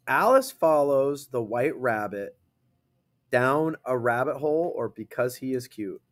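ordinary talk

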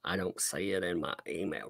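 ordinary talk

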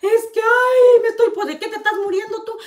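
high, wailing whine of a sister faking distress; mock crying on the phone. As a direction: imitate her sobbing down the phone, ham it up. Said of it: "Le habló llorando a mi mamá".

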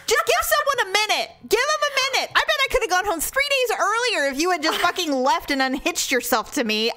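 high, indignant mock rant; acted exasperation. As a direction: protest as if finally proven right. Acting out exasperated vindication at doctors.

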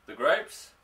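a woman repeating her sweetheart's words, surprised; puzzled echo. A narrator's reading. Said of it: "Nancy echoed".

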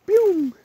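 high playful pew, mimicking a quick getaway; a quick cartoon zoom-off noise; mock pew sound. Sound of running off.

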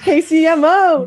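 loud, high, drawn-out cheer; hyped shout-out. Acted. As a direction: belt it out like a rap hype call. Hyped shout-out of hometown song.

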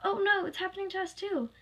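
high, urgent cry of dismay; high-pitched acted alarm; alarmed call. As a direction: call out in sudden panic. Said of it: "the other animatronics called".

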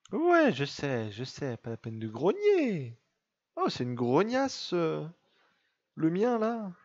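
drawn-out, high-pitched mock complaint; playful pet scolding. Real, not acted. Owner answering a growling pet, playful high scolding. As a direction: talk back to a grumbling pet, stretching the words.

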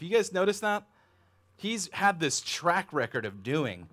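usual speaking voice

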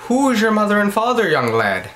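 high, lilting Irish brogue; a weak Irish accent. Shown as a demonstration. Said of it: "you'd say it like".

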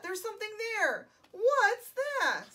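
high, loud, stretched question; storybook fright, voice jumping up; startled alarm. A narrator's reading. Boy sees something in woods, startled.

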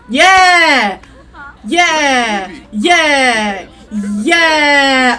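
long, loud drawn-out cheers; whooping yell at the screen. Real, not acted. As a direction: yell each yeah and let it fall. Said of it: "can't help but yelling at things".

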